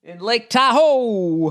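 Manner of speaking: Tahoe stretched high and slow; high drawn out. Acted. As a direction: sing out the place name slowly. Drawn out place name.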